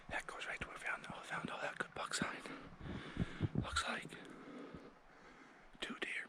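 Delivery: hushed whisper while stalking. Real, not acted. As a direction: whisper so the deer can't hear.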